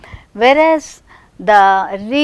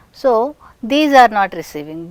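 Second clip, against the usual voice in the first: normal talking voice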